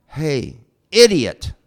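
acted sharp, scolding shout; rude call. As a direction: call out an insult, punching the last word. Retold rude call to stranded climber.

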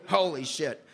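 usual speaking voice

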